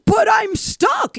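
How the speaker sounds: high, slow shouted plea; stuck drunk. Retold or imitated. Drunk stuck in the yard, shouting slowly to the dad. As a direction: wail it out, helpless and loud. Drunk driver voiced in story.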